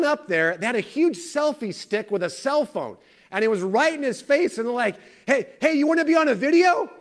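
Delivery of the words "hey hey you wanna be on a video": high, loud mock teenager pestering; excited kids imitation. High school kids accost man with selfie stick, excitedly. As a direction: act out kids calling out eagerly.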